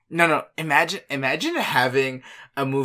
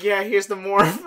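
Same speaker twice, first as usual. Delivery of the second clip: laughing quote; 6 semitones higher than usual. Retold or imitated. Power Rangers quote, laughing.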